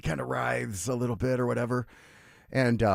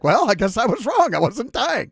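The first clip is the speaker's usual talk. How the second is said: sheepish grandpa; 11 semitones higher, 6 dB louder than usual. Retold or imitated.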